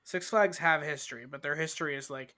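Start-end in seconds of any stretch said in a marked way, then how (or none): none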